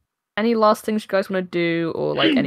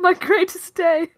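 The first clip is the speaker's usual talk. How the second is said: moved chef; 11 semitones higher, 3 dB louder than usual. Acted. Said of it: "he just goes".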